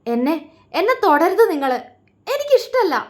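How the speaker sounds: high, loud furious scream; wife pushing husband away, screaming. A narrator's reading. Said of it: "അവൾ അലറി".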